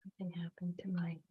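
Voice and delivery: quick, whispered aside to self. Real, not acted. Host checking a glitch before restarting, whispered aside. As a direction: whisper it under your breath, hurriedly.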